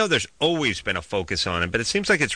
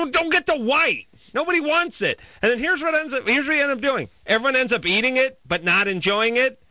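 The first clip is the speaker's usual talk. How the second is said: high-pitched exasperated rant; worked-up rant; 10 semitones higher than usual. Real, not acted. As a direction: rail against it, pitch way up.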